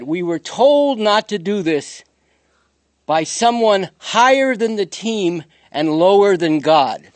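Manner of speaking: slow, weighty, each phrase pressed down; deliberate quote. Retold or imitated. Lecturer quoting Michael Cooper, slow and weighty. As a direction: lay out every word as if coded. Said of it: "He said".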